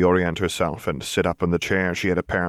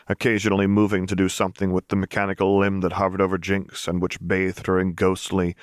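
ordinary delivery

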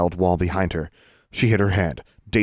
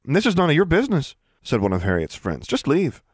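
dismissive; 8 semitones higher than usual. Acted. Bully dismissing newcomer.

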